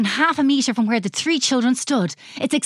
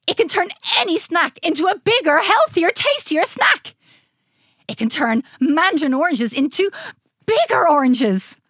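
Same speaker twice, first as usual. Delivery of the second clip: high, emphatic, boastful pitch; excitable mad scientist; 7 semitones higher, 3 dB louder than usual. A narrator's reading. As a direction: proclaim each wonder with relish.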